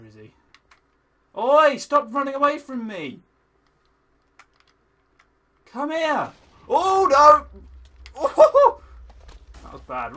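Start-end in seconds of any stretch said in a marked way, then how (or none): 1.4-6.3 loud high shout; chasing call. Real, not acted. Chasing the ghast. 6.7-8.8 loud high cry into laughter; laughing panic; panicked laugh. Real, not acted. Near death.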